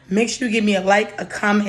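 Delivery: ordinary talk, nothing marked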